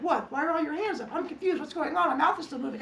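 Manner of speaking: put-on high, loud puzzled kid voice; clueless kid. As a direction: play the oblivious talker. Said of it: "that one kid's like".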